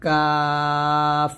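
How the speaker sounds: কাফ held long and thin, light; light Arabic kaf. Shown as a demonstration. Teacher demonstrating a thin কাফ to students. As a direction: draw কাফ out lightly in recitation tone. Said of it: "কাফটা পাতলা করে উচ্চারণ করবেন".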